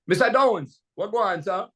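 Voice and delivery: Jamaican DJ patois. Shown as a demonstration. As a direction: shift into Jamaican DJ talk. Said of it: "let me shift gears right now".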